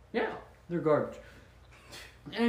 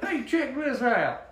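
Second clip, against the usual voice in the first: put-on southern accent; 7 semitones higher, slower than usual. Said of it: "He's gotta have a southern accent".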